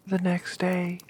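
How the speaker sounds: ordinary delivery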